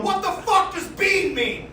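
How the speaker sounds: high, slow, dumbfounded mock voice; clueless guys. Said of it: "the guys in the chat room going".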